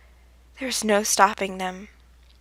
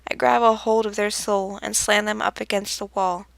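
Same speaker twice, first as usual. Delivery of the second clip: plain reading voice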